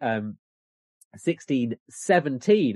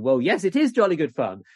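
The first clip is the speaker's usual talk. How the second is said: emphatic agreement, higher and louder; lightly acted gentry, raised and insistent; local gentry replying; 6 semitones higher, 5 dB louder than usual. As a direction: lean into it like an eager local complainant. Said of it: "gentry sort of say to him".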